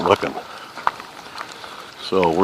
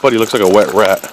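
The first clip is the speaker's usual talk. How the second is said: ordinary delivery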